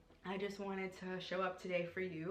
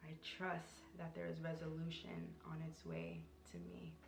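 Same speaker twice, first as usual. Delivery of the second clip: soft spoken affirmation; 7 dB quieter than usual. Shown as a demonstration. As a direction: breathe the words as a quiet mantra. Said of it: "Even affirm that too".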